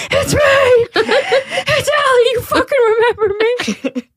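very high sobbing squeal through laughter; hammed-up wailing. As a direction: wail it like a hysterical child phoning mom. Wailing to mom on the phone.

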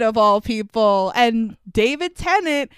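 ordinary talk, nothing marked

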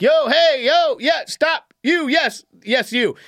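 high, staccato retold hollers; calling out. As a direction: flag down a stranger with short high calls.

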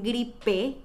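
teacher stressing the last syllable of "gripe"; stress on final E. Shown as a demonstration. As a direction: gripe split up, with a punched final "pe". Contrasting gripe with gripa.

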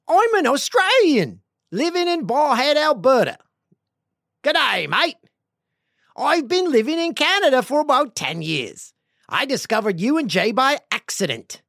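high, loud, hammed-up Australian accent; bad Aussie accent. Host reading an Australian listener's email, badly faked Aussie accent. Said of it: "I can't do an Aussie accent".

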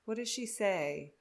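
ordinary delivery

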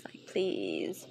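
breathy whispered please; a soft pleading whisper to the listener. Real, not acted.

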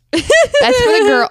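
high, slow scoff. Real, not acted. Scoffing at the order.

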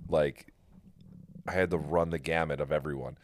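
ordinary talk, nothing marked

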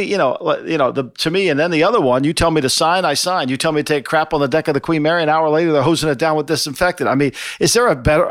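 normal talking voice

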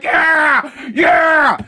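loud, high mock cheering; mock crowd cheer. Mimicking supporters cheering the president's words, mock cheer. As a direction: whoop like a worked-up supporter.

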